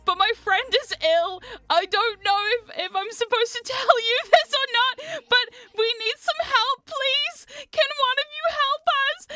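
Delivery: high, rising, giggly plea; awkward pleading. Retold or imitated. Teen asking snarling chainsaw actor for help, awkwardly pleading.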